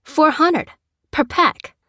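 high, emphatic, impatient price quote; impatient repeat. Acted. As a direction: spell it out for someone slow. Seller repeats price to disbelieving customer.

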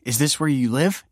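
a lightly voiced young woman questioning; Kelsey's voice. A narrator's reading. Young woman quizzing a stranger, lightly voiced.

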